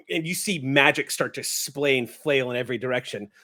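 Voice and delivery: usual speaking voice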